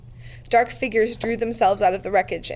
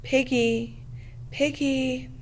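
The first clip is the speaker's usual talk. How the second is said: horrible whisper; 4 semitones higher, much slower than usual. A narrator's reading. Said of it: "A voice whispered horribly outside".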